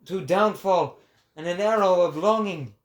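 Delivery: proclaiming prophet. Acted. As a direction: preach it to the crowd like a prophet. Prophet preaching to the people.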